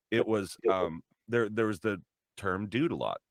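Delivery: usual speaking voice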